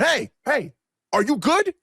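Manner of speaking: calling over to check, pitch climbing; Tracy Morgan. Retold or imitated. Imitating a star checking on a guest, high calling-out. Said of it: "he called over and he went".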